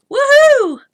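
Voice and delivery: high loud woohoo; real high celebratory cheer. Real, not acted. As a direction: let out a gleeful whoop. Celebrating her Friday.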